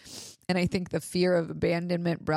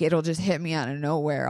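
ordinary talk

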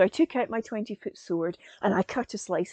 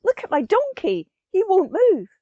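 put-on pleading old man, louder and higher; a mildly voiced distressed old man; upset old man; 7 semitones higher, 9 dB louder than usual. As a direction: call out for help over the stuck donkey. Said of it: "And he said".

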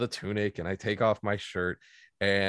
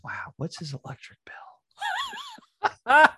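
a breathless high whisper, much higher; whispered thought; much slower than usual. Retold or imitated. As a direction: whisper it to yourself in disbelief, slow. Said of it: "the only thing that was going through my head was".

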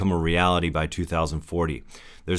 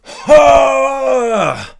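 excited whoop; 10 dB louder than usual. Real, not acted. Excited outburst before Superman joke.

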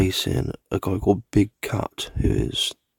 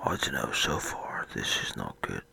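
weak whisper; 3 semitones lower, 9 dB quieter than usual. Real, not acted. Ill, weak, short of breath.

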